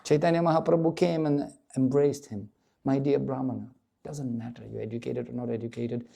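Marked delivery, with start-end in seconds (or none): 2.8-6.1 tender reassurance. Acted. Chaitanya comforts the Brahmana.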